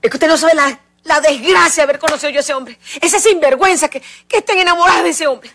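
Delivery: loud, high, furious complaint; angry outburst. Acted. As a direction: spit out her rage at the scoundrel. Pastora furious about the man.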